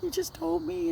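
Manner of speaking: very high, tearful-style wail retold; imitated bawling. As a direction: wail it as if bawling to a husband on the phone. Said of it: "and I'm bawling".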